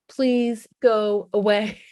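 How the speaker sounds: drawn-out, slow imitation of a sulky teen; mild mock sulky child; slow mock sulky kid. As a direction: whine it slowly like a kid shutting you out. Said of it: "Unless they tell you to, like".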